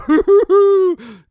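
high, loud scornful laugh; mocking laugh. Real, not acted. Laughs at Trudeau mention.

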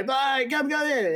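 high-pitched imitated call to a neighbour. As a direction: act out calling over a fence, no extra volume. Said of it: "I call out to my".

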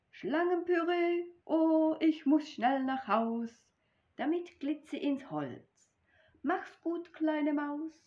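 a snake rushing home in fear; panicked snake. Acted. Snake hurries away from mouse, panicked and high. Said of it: "sie fürchtet sich so".

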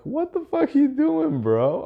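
high-pitched, loud mock outrage; retold angry disbelief. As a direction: act out indignant shock at a friend. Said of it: "I was really angry".

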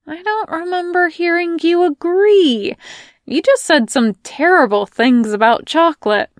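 high, loud comeback; sassy retort. Acted. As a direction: fire back at him. Melanie retorting.

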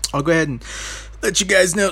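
hiccuping mid sentence; talking through hiccups. Real, not acted. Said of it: "Sorry, I got the hiccups".

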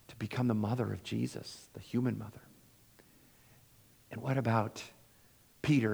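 ordinary talk

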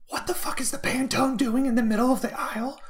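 screaming rant in a very high, loud voice; furious screaming manager. Retold or imitated. Manager screaming at the supervisor about the aisle, hammed-up fury. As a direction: blow up at the worker over the aisle.

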